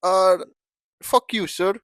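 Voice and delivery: put-on furious call-center agent, Indian accent; angry Indian accent. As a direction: swear at him in an Indian accent. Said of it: "you also have an Indian accent".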